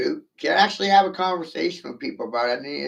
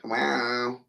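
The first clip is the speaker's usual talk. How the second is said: normal talking voice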